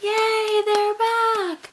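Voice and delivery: high, drawn-out acted cheer; hammed-up celebratory whoop; mock crowd cheer. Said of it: "where everyone's like".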